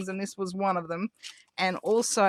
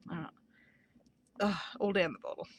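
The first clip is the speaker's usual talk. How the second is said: ordinary talk, nothing marked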